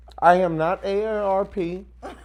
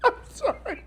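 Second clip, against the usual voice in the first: much higher, giggling apology; can't stop laughing; 14 semitones higher than usual. Real, not acted. Apologising to the caller while laughing too hard to go on.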